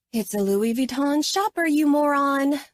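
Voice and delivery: high, lilting sing-song. A narrator's reading. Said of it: "in a sing-song voice".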